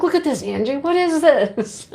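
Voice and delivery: retold amazement, rising high and slowing; amazed discovery. Shopper showing Angie bagel-shaped yarn, amazed discovery. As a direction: act out marvelling at an odd find.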